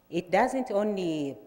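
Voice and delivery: ordinary delivery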